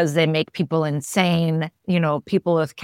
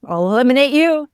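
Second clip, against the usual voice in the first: mock threat; 3 semitones higher, 5 dB louder than usual. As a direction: growl it as a joking threat. Friend joking mock threat.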